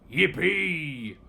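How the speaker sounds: loud cry of glee; gleeful cheer. A narrator's reading. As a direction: cheer like kids let loose. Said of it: "cried out".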